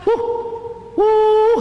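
high hooting woo heard far off; distant bunyip reply. Acted. Hunter retells hearing the bunyip's far-off high hooting answer. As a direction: call back like a creature in the distance. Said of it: "And I heard".

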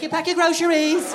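very high, loud British lady; hammed-up high-pitched British nanny; Mary Poppins. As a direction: trill it in a high mock-British voice. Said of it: "Mary fucking Poppins came by".

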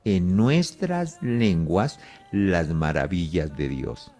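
reading the verse aloud, unhurried; slow scripture reading. Shown as a demonstration. Preacher reads verse eleven, slow and deliberate. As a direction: slowly read out, word by word. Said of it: "dice la palabra en el verso once".

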